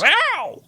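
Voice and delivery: high, loud, drawn-out yelp; cartoon pain yell. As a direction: imitate a cartoon character howling in pain. Imitating Raphael's tape rip yell.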